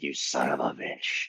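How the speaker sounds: low, quiet growl of mock fury; hammed-up furious boss, low and soft. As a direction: seethe under your breath as the boss. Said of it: "like, furious".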